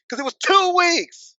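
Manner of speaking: high, drawn-out exasperated cry; exasperated rant. Real, not acted. Ranting at internet haters about the title, exasperated. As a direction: stretch it out high, utterly fed up.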